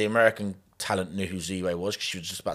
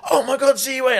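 much higher, gushing; excited squeal; 8 dB louder, slower than usual. Retold or imitated. Emily spotting her friend in the crowd, excited. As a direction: squeal it like a starstruck fan.

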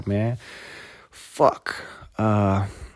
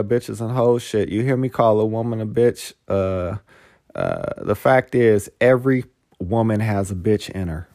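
ordinary talk, nothing marked

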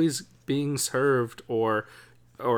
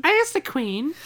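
much higher posh royal voice; Queen impression. Host greets cohost as the Queen, much higher and posher. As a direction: float it up high like royalty. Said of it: "are we doing an accent thing?".

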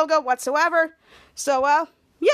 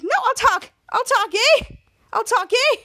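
high, louder acted surrender; mock desperate pleading; 7 semitones higher, 5 dB louder than usual. Third muchacho avoiding the hug, high mock pleading. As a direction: give in with pretend panic.

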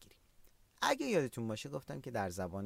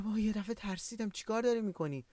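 higher-pitched, startled reproach; demonstrated fright, pitched up; acted shock; 9 semitones higher, faster than usual. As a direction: react as if someone just made you jump.

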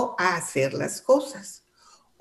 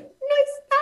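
much higher, small frightened voice; timid child. Retold or imitated. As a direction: say it like a scared little girl. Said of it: "ni voz me salía". (voz de niña asustada, mucho más aguda)

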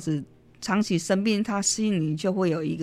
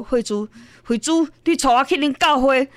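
eager, louder calls of her name; a mother suddenly begging her daughter, eagerly; excited plea; 7 semitones higher, 6 dB louder than usual. Retold or imitated. Mother urging daughter to take her to church, excited. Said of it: "她就很激动".

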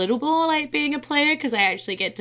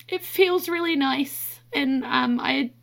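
crying; 6 semitones higher than usual. Real, not acted. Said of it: "sorry, I'm crying on the podcast".

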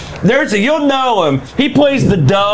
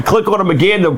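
normal talking voice

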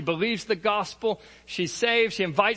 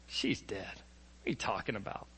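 scoffing whisper; 9 semitones lower, 13 dB quieter than usual. Acted. Mourners scoff at Jesus.

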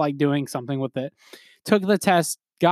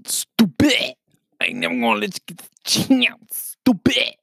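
high-pitched mock rapper yelling; six nine; 7 semitones higher than usual. Imitating a wild rapper's song, six nine impression. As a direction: squeal it like a hammed-up rap taunt. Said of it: "from a New York accent to six nine".

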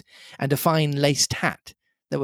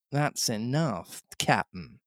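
plain reading voice

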